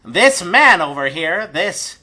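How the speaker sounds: high-pitched stage announcement; gnome showman. Acted. Gnome introduces the fighter to the crowd, high showman's voice. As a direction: present each phrase grandly, with pauses.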